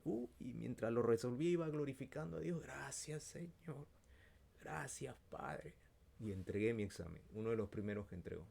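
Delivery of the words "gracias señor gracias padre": high, hushed thanks; soft grateful prayer. Retold or imitated. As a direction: murmur thanks upward. Agradecimiento susurrado a Dios. (gracias en voz baja)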